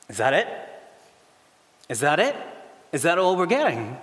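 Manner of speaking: rising, let-down questions; incredulous disbelief. Acted. Hired workers stare at one coin, incredulous.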